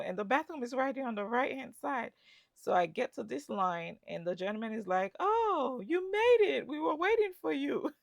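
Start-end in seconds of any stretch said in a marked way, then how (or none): 5.2-7.9 cheerful gate agent. Retold or imitated. Gate agent greeting her.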